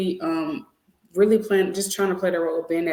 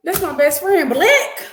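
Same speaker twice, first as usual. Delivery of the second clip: higher, louder meme quote; quoting a video; 11 semitones higher, 7 dB louder, slower than usual. Retold or imitated. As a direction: quote the viral clip. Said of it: "You know the video?".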